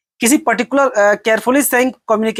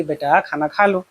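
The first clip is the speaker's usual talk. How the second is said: softer, lower and slow, tender coaxing; loving mother; 4 semitones lower, 4 dB quieter, slower than usual. Acted. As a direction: gently coax like a mother. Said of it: "माँ जैसे आपको प्यार से बोलेगी".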